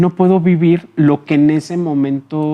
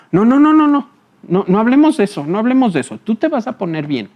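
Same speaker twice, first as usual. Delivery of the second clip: panicked son's refusal; 6 semitones higher, faster than usual. Retold or imitated. Son brushing off his dying father's request about the will, high and alarmed. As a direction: cut the sick parent off in alarm, then try to sound upbeat.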